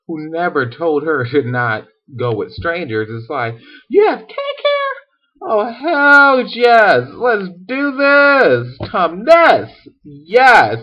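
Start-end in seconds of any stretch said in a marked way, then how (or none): 3.9-10.8 slow, high squeal growing louder; drawn-out, high-pitched mock thrill; hammed-up excitement. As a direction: gush with over-the-top childish glee. Acted Lucy thrilled by cake offer.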